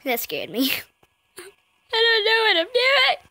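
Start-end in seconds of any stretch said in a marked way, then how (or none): 1.9-3.2 high-pitched, helpless squeal; high flustered whine. Real, not acted. Flustered in the game.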